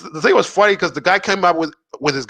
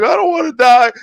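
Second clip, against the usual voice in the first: higher, louder imitated wail; mock crying plea; 7 semitones higher, 5 dB louder than usual. Drunk boyfriend pleading with the cop, mock crying. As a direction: plead as if crying, lightly acted. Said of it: "but he's crying".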